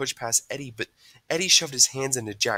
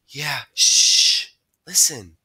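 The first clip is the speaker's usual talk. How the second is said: hushing; 3 semitones higher, 9 dB louder, much slower than usual. A narrator's reading. Hushing friends to listen.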